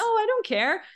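high-pitched mock indifference; people-pleaser mimicry. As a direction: imitate an overly agreeable partner in a high voice. Mimics the agreeable nice guy.

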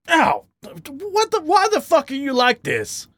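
high-pitched exasperated outburst; snapped. A narrator's reading. Badger hit by a thrown peanut, snapping at his partner. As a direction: snap in pained outrage. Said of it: "the badger snapped".

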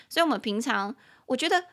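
usual speaking voice

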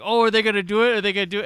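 keyed-up "will they do it?" energy; excited anticipation. Retold or imitated. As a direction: act out an audience getting worked up. Acting out excited movie watchers.

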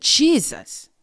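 name shouted high and loud, with full force; loud cry of the name. Retold or imitated. Recalling the demon attack, shouting the name for protection, loud. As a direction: cry out Jesus as loud as you can against the attacker. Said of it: "as I got loud and I said".

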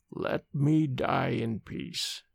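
very slow, low and soft; said slowly. A narrator's reading. Dying man begging the nurse, very slow. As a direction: drag it out, exhausted. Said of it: "he said slowly".

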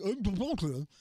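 garbled, mushy mumbling; garbled mumble. Retold or imitated. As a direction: run the words together into gibberish. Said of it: "More like".

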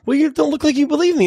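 high-pitched, imitated friend's objection; retold reproach. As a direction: quote the friend's protest up high.

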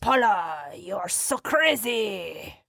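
put-on slow, drawn-out French accent; mock French movie accent. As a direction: stretch it out like a suave French film husband. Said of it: "for that French accent".

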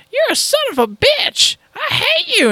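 very high falsetto insult; ungrateful damsel. Retold or imitated. Freed woman curses the streamer who saved her, high falsetto outrage. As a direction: snap at your rescuer in a squeaky woman voice. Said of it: "she goes".

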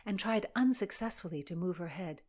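usual speaking voice

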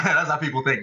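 ordinary talk

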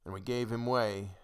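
ordinary delivery, nothing marked

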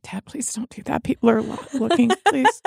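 put-on high, hushed teenage plea; embarrassed pleading. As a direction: beg dad under your breath, mortified. Voicing embarrassed teenage son pleading.

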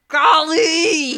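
loud, high furious yelp; screamed substitute curse. Retold or imitated. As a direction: scream a clean curse in pain and rage. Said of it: "I wanted to scream".

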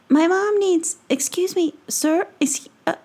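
put-on nervous stammering boy. Tom stammers to the peddler.

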